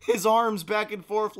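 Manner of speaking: ordinary delivery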